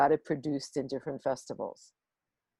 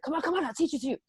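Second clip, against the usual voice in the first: eager coaxing; 11 semitones higher, much faster than usual. Retold or imitated. Man eagerly teaching the song.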